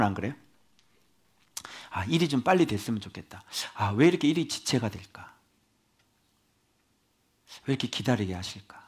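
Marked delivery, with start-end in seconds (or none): 1.9-8.8 soft, sighing complaint to God; hushed impatient sighing; quiet inner grumble. Retold or imitated. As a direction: mutter it under your breath. Voicing his impatient inner grumbling.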